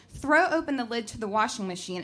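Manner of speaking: plain reading voice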